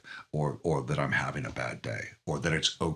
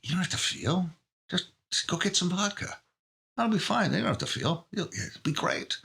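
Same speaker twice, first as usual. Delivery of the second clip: high-pitched inner voice of temptation; coaxing addictive mind; 10 semitones higher than usual. Acted. Addictive mind talking itself out of feeling, high coaxing voice. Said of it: "this is how addictive minds work".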